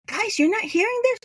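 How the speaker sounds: high, incredulous question to the group; can't believe they don't hear it; startled disbelief. Retold or imitated. Asking her friends by the forest, high and incredulous.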